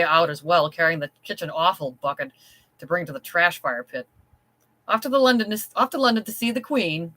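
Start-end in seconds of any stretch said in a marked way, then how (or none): 4.9-7.1 high, singsong mocking jeer; mocking taunt. Kitchen boy jeers at the maid, high and mocking. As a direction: tease her like a cheeky kitchen boy. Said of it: "he asked Fiona mockingly".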